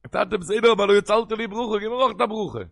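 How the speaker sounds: loud, high-pitched begging; impassioned plea. Retold or imitated. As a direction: cry out urgently for a share. Acting Esau begging for blessing.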